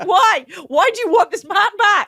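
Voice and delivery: high, loud, laughing mock plea; cracking up while playing the exasperated friend; laughing mock disbelief. Mocking a woman who takes her drunk man back, laughing loudly.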